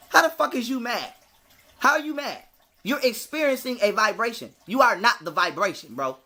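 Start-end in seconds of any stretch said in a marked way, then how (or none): none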